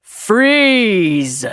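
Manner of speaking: loud, sharp command; yell. A narrator's reading. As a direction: stop everyone dead with a shout. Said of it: "He yelled".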